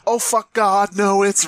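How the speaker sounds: normal talking voice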